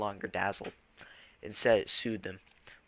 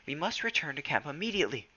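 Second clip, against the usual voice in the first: brief urgent order; 6 semitones higher than usual. A narrator's reading. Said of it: "Bluestar meowed briefly".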